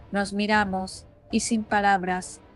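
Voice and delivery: ordinary delivery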